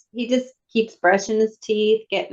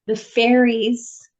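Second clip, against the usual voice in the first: ordinary talk, nothing marked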